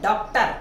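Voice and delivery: "डॉक्टर" with a rounded open ऑ; "डॉक्टर" said the English way with open ऑ; English o in doctor. Shown as a demonstration. Teacher shows students how everyone says "डॉक्टर" with the open ऑ. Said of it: "सब डॉक्टर बोलते हैं".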